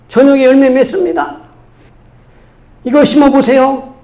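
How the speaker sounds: high, projected sales pitch; boy hawking miracle seeds; imitated seed seller. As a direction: pitch it like a street vendor to a crowd. Boy's sales pitch aimed at father.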